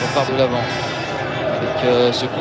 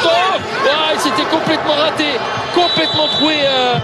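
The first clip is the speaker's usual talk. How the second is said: excited exclamation; 11 semitones higher than usual. Real, not acted. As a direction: cry out at the blunder. Keeper misses the ball completely.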